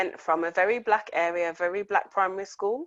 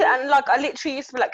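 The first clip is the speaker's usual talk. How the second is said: ordinary talk, nothing marked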